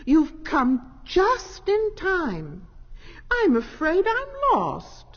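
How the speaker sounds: high, slow and loud with relief; grateful cry. A narrator's reading. Lost cat welcoming the lights, high and grateful. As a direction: plead gratefully, close to tears. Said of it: "cried Snoopy Cat gratefully".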